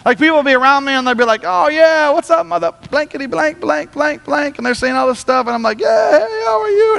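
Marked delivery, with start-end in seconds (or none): 1.4-4.6 higher-pitched mock trash talk; playful imitation of a foul-mouthed greeting; imitating casual swearers. Imitating people swearing around him. 5.8-7.0 much higher, strained cheery hello; put-on polite small talk; mock friendly greeting. Pastor's reply to swearing people.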